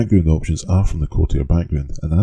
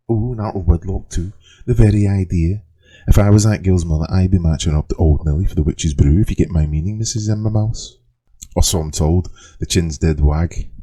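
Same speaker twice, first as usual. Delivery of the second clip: put-on lightly done Liverpool accent, reading a backstory; a light Scouse accent for the character's story; a light attempt at a Liverpool accent, reading the tale aloud; Liverpudlian accent. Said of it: "read this backstory in a Liverpudlian accent".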